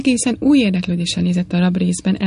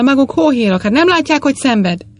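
higher, louder accusing cry; agitated reproach; 6 semitones higher, 3 dB louder than usual. A narrator's reading. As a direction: hurl it at them as cruel men. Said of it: "erős felindulással".

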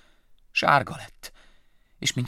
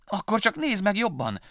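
higher mouse voice, pressing hard; insisting; 12 semitones higher, 3 dB louder than usual. Acted. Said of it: "erősködött Willy".

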